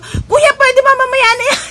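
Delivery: high, loud laughing protest; exasperated shout. Retold or imitated. To the driver stopping at the barangay hall, laughing loud protest. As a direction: yell at him to drop it for later. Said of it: "Sabi ko".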